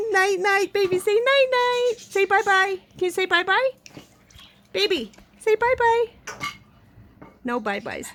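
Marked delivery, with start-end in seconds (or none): 0.1-6.1 much higher, sing-song prompting. Real, not acted. Owner coaxing her parrot to say bye-bye, high coo. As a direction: coax the bird to talk.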